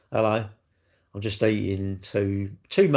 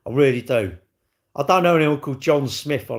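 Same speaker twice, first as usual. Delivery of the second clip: ordinary talk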